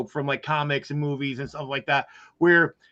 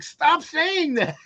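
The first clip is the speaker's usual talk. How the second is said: slow and much higher, a drawn-out plea; pleading protest; 10 semitones higher, much slower than usual. Retold or imitated. The shrink guy begs the villain, slow and high-pitched. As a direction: stretch it out high, begging him to stop. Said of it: "He's like".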